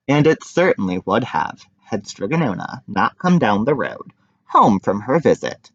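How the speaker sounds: ordinary delivery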